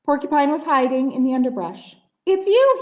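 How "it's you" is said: high, loud startled cry. Acted. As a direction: jump at the sight. Startled porcupine.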